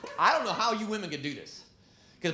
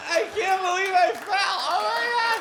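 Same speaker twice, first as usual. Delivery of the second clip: put-on drunk wife; 12 semitones higher, 4 dB louder than usual. Wife after falling at a party, high giddy drunk voice. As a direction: ham up the tipsy wife on the floor.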